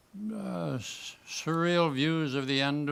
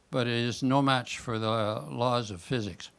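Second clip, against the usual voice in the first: usual speaking voice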